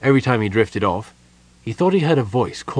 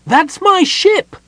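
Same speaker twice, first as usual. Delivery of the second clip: slow, very high, loud cry; gnome's bellow; 9 dB louder, much slower than usual. Acted. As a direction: bellow it high, drawing out the words. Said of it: "the gnome bellowed".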